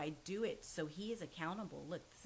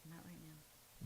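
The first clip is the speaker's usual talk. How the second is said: slow, much softer, distracted murmur; half-turned away, quietly waving off voices only he hears; murmuring to unseen voices; 11 dB quieter, slower than usual. Son in psychosis answering hallucinated voices, slow soft murmur.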